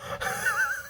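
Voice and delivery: squealing burst of laughter, high; high shrieking laugh. Real, not acted. Laughing at the count's insult, high squeal.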